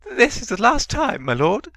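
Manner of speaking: very high, loud desperate plea; pleading servant. A narrator's reading. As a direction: beg your master frantically. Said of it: "Wormtail pleaded".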